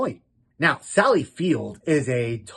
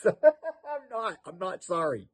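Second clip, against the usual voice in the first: laughing; 3 semitones higher, 7 dB quieter than usual. Real, not acted. Laughing fit after the clip.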